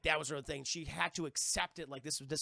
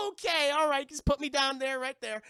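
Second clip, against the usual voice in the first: higher, louder mock-flustered voice; 8 semitones higher, 8 dB louder than usual. Grabbed character blushing as he lifts her down, mock flustered blurting. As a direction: blurt it high, as if embarrassed. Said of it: "like, blushing and everything".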